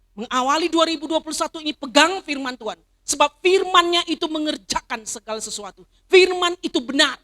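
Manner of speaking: rally them into the new year; rousing exhortation. Acted. Pastor urging the church to hold God's word, loud and high. Preaching at full force.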